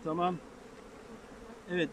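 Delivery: ordinary delivery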